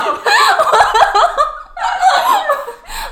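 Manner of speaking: long high laugh climbing up; rising laugh. Real, not acted. Laughing at herself after saying she's delirious. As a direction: laugh at your own joke.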